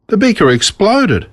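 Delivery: ordinary delivery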